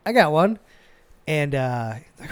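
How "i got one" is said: retold eager call from the audience. Employee volunteers a question to the VP when nobody dares, calling out. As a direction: pipe up brightly to be noticed, higher and louder. Pipes up in silent meeting.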